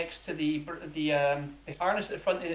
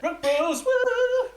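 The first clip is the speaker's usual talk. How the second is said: loud, high singsong whoop; hammed-up bro celebration; whooping bro cheer; 7 dB louder, much slower than usual. Game master acts out a high five, whooping.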